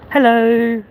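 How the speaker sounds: mock cheery high hello; sing-song hello. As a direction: imitate greeting strangers. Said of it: "Just going".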